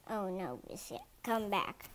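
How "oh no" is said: ordinary delivery, nothing marked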